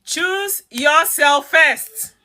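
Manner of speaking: "Choose yourself first" stretched very slowly, every word leaned on; very slow emphasis. Real, not acted. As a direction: spell the point out as slowly as you can. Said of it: "emphasize on that enough".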